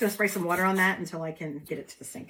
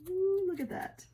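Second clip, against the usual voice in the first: delighted ooh; 12 semitones higher, slower than usual. Real, not acted. Admiring the peeled stencil.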